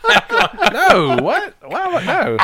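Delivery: high, slow, laughing protest; laughing denial. Real, not acted. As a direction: squeal a flustered no. Denying with laughter.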